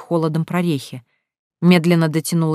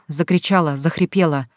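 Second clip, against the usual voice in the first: plain reading voice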